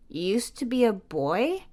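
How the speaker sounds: puzzled question. A narrator's reading. Jamie questioning Tia's past, small and amazed. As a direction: ask it in disbelief. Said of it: "Jamie questions".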